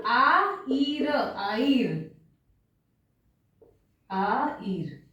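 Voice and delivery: आइर slowly as a-EER, no H at the start; silent H. Shown as a demonstration. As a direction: open straight on the vowel, then split आइर in two. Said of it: "यहाँ एच pronounce नहीं होगा".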